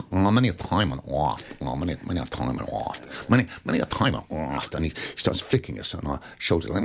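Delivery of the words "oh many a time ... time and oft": lightly voiced Dustin, low and murmured, savouring the line; a hint of the actor chewing over his first line; imitating Dustin Hoffman. Retelling how Dustin said his first line at rehearsal, lightly mimicked. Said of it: "so he looked at the two of us standing".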